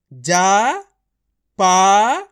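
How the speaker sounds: जा and पा each loud and held long; syllable by syllable. Shown as a demonstration. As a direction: sound out जा, then पा, with a gap between. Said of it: "खींच खींच के पढ़ना है".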